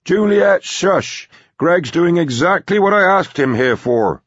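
put-on high, forceful old man's rebuke; scolding grandpa. Grandpa hushing his granddaughter, scolding.

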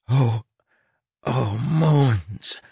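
hoarse, frightened rasp. Acted. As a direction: rasp it out in dread. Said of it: "Tress rasped".

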